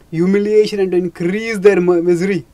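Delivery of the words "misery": ordinary delivery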